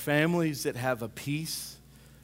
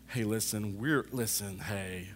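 mock nervous surgeon; 4 semitones lower, 3 dB quieter, much slower than usual.

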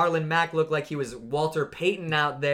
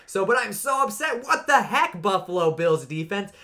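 high, loud, exasperated cry; cracking up into an indignant yelp; exasperated outburst; 5 semitones higher, 4 dB louder than usual. Real, not acted. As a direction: let the frustration burst out high. Fantasy rant about Bills defense.

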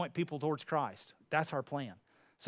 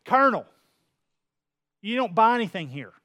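called out; 4 semitones higher, 8 dB louder than usual. Retold or imitated. Bystander calls out to colonel.

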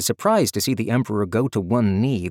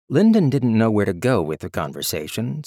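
usual speaking voice

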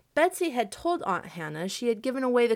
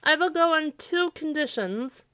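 high, louder scarecrow voice; 9 semitones higher, 5 dB louder than usual. A narrator's reading. Scarecrow sets terms for Santa, high voice. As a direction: pitch it high and firm.

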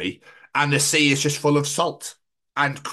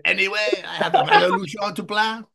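put-on high, comic French accent; 8 semitones higher than usual. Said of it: "French accent really tickle me".

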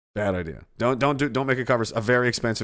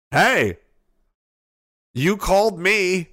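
mock callback; 5 semitones higher, 4 dB louder, much slower than usual. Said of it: "And I'm like".

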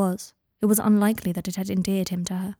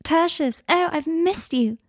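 put-on high, slow, sugary girlfriend gushing; sweet high girlfriend voice, drawn out slowly; 8 semitones higher, 3 dB louder, slower than usual. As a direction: gush like a doting young girlfriend. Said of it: "hoisting on her girlfriend voice".

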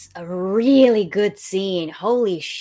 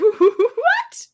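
high, loud, slow incredulous laugh; laughing disbelief; 6 dB louder than usual. Real, not acted. Reacting to Richard's better-husband claim, laughing disbelief. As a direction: laugh into an astonished question.

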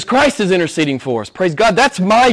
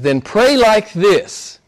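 higher, louder, slow start; prayerful recitation; 6 semitones higher, 5 dB louder than usual. Acted. Preacher reciting the Lord's Prayer, raised and reverent. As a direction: lift the words up as prayer. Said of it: "Jesus says".

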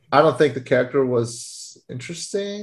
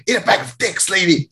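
sudden higher-pitched retort, acted; mock angry outburst; 4 semitones higher than usual. Reviewer acts out the man shushed on a train, mock outburst. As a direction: snap it as a character losing patience. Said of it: "Anger issues".

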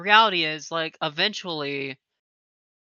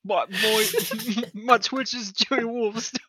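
higher-pitched, drawn-out Jimmy imitation; Uncle Jimmy impression; 7 semitones higher, 5 dB louder than usual. Pretending the absent friend is on the show, hammed-up impression. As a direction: put on a high, slow comic voice for him. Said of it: "we have him on the show right now".

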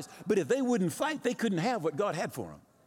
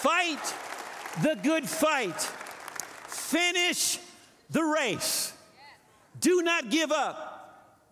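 rally the congregation like a war cry; roaring rallying speech; 7 semitones higher than usual. Real, not acted. Pastor's climactic battle cry.

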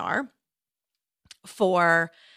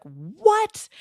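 sharp, much higher shocked "What?"; shocked husband; 12 semitones higher than usual. Retold or imitated. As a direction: jump up in pitch like a stunned husband. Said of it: "then about flipped out".

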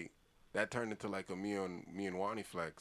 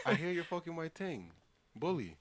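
laughing; 7 semitones higher than usual. Retold or imitated. Retold quote while laughing.

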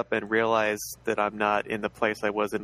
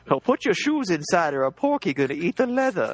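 put-on raised, twangy old-timer voice; old prospector; 6 semitones higher than usual. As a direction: play a grizzled old prospector giving advice. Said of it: "That's old prospector wisdom right there".

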